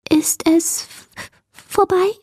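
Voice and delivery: timid, high little creature voice; trembling voice. A narrator's reading. Hidden creature asking from the cupboard, trembling and halting. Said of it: "fragte eine zitternde Stimme".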